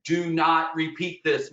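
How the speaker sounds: slow, firm warning on not; stress on not. Real, not acted. Guide warning viewers, slow stress on not. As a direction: Do not repeat this, slow with weight on not.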